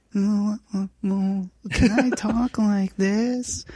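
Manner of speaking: slow, high sweet-voiced mother; Michelle Duggar voice. Friend asks him to read her statement as Michelle, high and slow. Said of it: "you gotta do your best Michelle Duggar voice".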